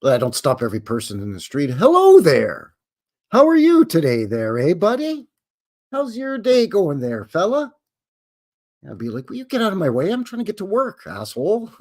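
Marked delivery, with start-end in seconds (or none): 1.7-7.7 hammed-up neighbourly chatter to a stranger; overly friendly greeting. Mock stopping people on street. 9.3-11.7 a higher, irritated brush-off; half-joking exasperated snap; mock annoyed. Mock annoyed reply to greeter.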